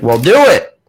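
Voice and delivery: lightly mimicked impatient Southern caller; quick Southern demand with a pitch jump; mock Southern insistence; Southern accent. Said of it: "he had a southern accent".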